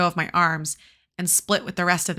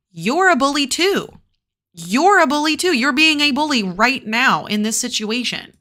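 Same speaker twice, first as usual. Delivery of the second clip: higher, louder indignant call-out; indignant scolding; 6 semitones higher, 5 dB louder than usual. Real, not acted. Reader calling out the bully Steve, indignant and louder. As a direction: scold the bully with rising outrage.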